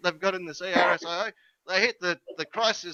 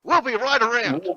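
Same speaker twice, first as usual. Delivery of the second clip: a touch higher, urgent order down the phone; lightly voiced urgent hotline worker; urgent official on the phone; 6 semitones higher, 4 dB louder, slower than usual. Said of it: "You watch them say".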